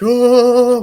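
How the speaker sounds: long, loud high-pitched oh; mock shock. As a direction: draw it out high in mock horror. Acts reaction to him undressing.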